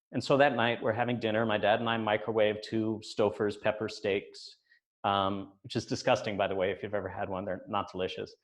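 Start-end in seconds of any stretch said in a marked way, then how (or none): none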